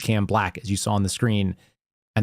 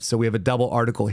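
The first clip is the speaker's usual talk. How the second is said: ordinary talk, nothing marked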